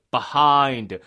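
"behind" as slow, loud "비하인드"; wrong Korean reading. Shown as a demonstration. Teacher rejecting the Korean reading, emphatic "behind" as "비하인드". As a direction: stretch "behind" into Korean syllables. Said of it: "절대로 behind는 아니야".

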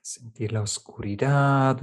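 usual speaking voice

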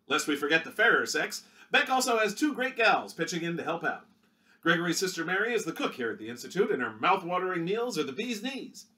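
punchy vintage newsreel read; newsreel announcer. Acted. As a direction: sell it like a 1930s Movietone narrator. Newsreel narrator continues.

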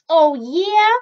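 loud, high cat comeback; defiant retort. A narrator's reading. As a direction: throw it back as a challenge.

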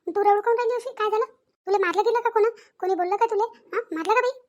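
worried village mother pestering her daughter; mother anxiously asking her crying daughter what happened; fussing mother. Acted.

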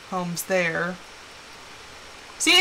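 ordinary talk

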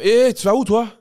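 sœur qui interpelle, voix haute et forte; interpellation imitée. Retold or imitated. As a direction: imiter une grande sœur qui intercepte quelqu'un. Sœur qui interpelle, scène racontée. (interpellation sèche et forte, imitée)

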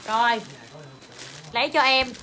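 normal talking voice

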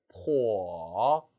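slow, low dipping 포어 that rises at the end; natural glide. Shown as a demonstration. Teacher modelling for learners, 포어 natural and drawn out. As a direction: blend 포어 smoothly through a dip. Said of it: "자연스럽게".

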